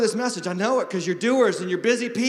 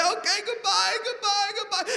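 much higher, sing-song and laughing; mock graveside farewell; 12 semitones higher than usual. Preacher parodying mourners' farewell, high and laughing. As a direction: wave off the casket cheerily. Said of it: "they say".

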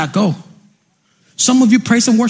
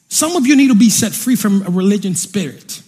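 usual speaking voice

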